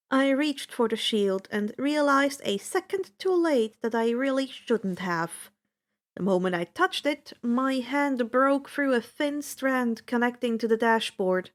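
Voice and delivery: ordinary delivery, nothing marked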